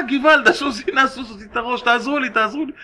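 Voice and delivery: high-pitched, urgent calling out; acted cry for help. Horse owner calling the shopkeepers back, crying out. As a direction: play a man raising the alarm. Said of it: "פתאום הוא התחיל לצעוק".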